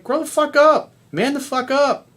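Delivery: high-pitched, emphatic rebuke; exasperated scolding. Real, not acted. Coach telling complaining men to grow up, exasperated scolding. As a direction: drive each command home, pitch leaping up.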